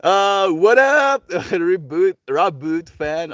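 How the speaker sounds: loud, high, drawn-out greeting; excited shout-out. Real, not acted. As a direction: holler a slow, hyped hello. Calling out greeting to arriving viewer.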